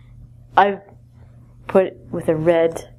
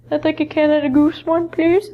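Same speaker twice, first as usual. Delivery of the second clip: playful order; 7 semitones higher than usual. Pretend. Jokingly orders a jacket.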